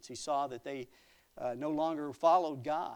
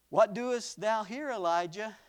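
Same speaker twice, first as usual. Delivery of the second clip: loud, high, slow questioning; God's challenge; 7 semitones higher, 7 dB louder than usual. Acted. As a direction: call Elijah out with a ringing question. Voices God questioning Elijah.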